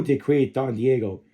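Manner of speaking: ordinary delivery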